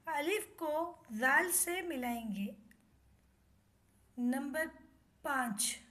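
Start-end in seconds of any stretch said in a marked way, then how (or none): none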